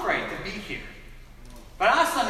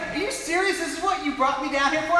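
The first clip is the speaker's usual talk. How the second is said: high-pitched mock disbelief; incredulous; 6 semitones higher than usual. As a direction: question him in exasperated amazement. Retold incredulous reply from the evangelist.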